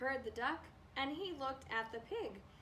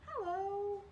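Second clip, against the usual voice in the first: high purring hello; purred hello; 7 semitones higher than usual. Acted. Cat purrs a hello at the farmer. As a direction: purr the word hello. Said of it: "the cat purred and let out a".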